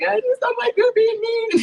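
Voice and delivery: very high, slow tearful wail; drawn-out mock crying whine; retold crying. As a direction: imitate a pitiful sobbing complaint. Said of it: "then I start crying".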